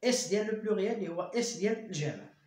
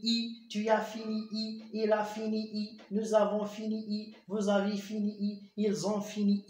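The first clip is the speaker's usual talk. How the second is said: slow dictation; much slower than usual. Shown as a demonstration. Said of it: "بحال هنايا جي برونونسي".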